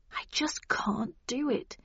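soft, half-whispered and slow; slow, reluctant. A narrator's reading. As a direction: say it slowly, almost under your breath. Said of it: "replied Jack slowly".

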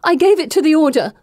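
loud, high desperate outburst; blurted out. A narrator's reading. Heroine trying to save her companion, blurting. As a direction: blurt the confession urgently. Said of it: "I blurt out".

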